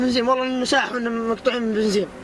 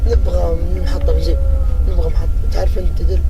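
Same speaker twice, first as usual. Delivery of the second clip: loud; 5 semitones lower, 9 dB louder than usual. Acted. Begging a stranger for petrol.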